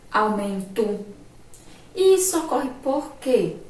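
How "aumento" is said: "Aumento" ending in an "oo" sound; final O as U. Shown as a demonstration. Teacher showing pupils the swapped vowel, "Aumento" ending in U. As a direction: say "Aumento" carefully, last O as U. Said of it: "A gente troca por U".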